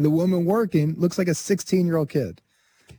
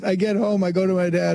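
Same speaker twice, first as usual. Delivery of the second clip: normal talking voice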